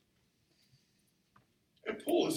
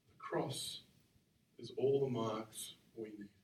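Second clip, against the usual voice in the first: hushed; 4 semitones lower, 6 dB quieter, slower than usual. Real, not acted. Hushed emphasis in sermon.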